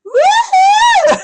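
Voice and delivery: shrieking woo-hoo then cackle; high whoop and laugh. Real, not acted.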